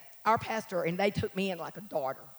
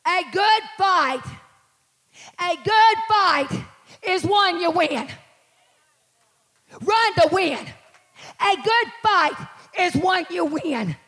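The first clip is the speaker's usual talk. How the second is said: loud, slow, raised pulpit cry; full-force preaching; 8 semitones higher, 7 dB louder, slower than usual. Real, not acted. Preacher telling church to run to win, emphatic shout. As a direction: punch out each short phrase.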